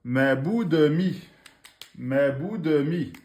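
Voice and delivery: ma bout de mi read slowly and clearly; slow reading demo. Shown as a demonstration. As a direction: model the word for the class.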